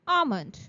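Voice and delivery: "Almond" said with no L, as "ah-mond"; silent L almond. Shown as a demonstration. Teacher modelling the word for the class, silent L. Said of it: "The L in almond is silent".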